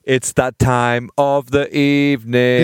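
slow, drawn-out segment announcement; stretched-out showman's build-up; mock ceremonial. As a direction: announce it with grand, playful ceremony. Ceremoniously introducing the show's regular segment.

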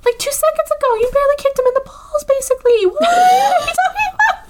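high, fast, disbelieving rant that ends in a laughing shout; high exasperated rant building to a shout; incredulous rant. Acted. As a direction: tear into her in mock outrage. Ranting at movie character.

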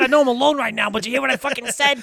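excited self-congratulation. Acted. Hitman walking away alone after his quip, giddy boasting. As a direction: crow over your own one-liner.

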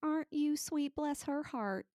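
high, sweet Southern belle drawl; imitated honeyed Southern accent. Host contrasting Southern with Texan, Southern accent. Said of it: "which is also the Southern".

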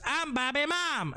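high mimicry of a repeated catchphrase; imitated catchphrase. Host mimicking Alfie repeating a catchphrase, high voice. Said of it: "Alfie repeats that now. He goes".

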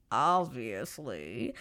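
OBVIOUSLY stretched very slowly, swooping up at the end; knowing emphasis. Real, not acted. Host on the uninvited suitor, drawn-out knowing "obviously". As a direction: draw out every syllable of "obviously".

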